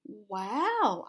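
mild exclamation of wonder; exclaiming. A narrator's reading. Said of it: "I exclaimed".

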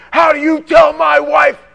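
husband yelling at pastor about his wife; angry husband shouting. Retold or imitated. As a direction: yell at the pastor. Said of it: "the husband calls".

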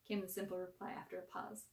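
ordinary delivery

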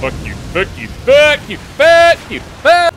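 from muttered to loud yelling; swearing that builds into a yell; building angry yelling. Real, not acted. Fighting an enemy.

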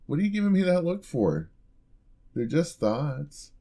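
high, indignant innocence; faux-hurt protest; mock offended. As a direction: play innocent at the dirty look. Reacting to a disapproving look.

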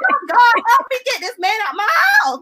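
begging God for help in exasperation; desperate plea. Retold or imitated. Woman retelling her prayer to get rid of the man, high and pleading. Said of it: "So I'm like".

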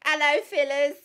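loud, high Essex caricature; hammed-up Essex greeting, pitched high; mock Essex accent. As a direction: holler it in broad Essex. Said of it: "You should be like".

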